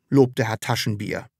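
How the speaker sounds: ordinary delivery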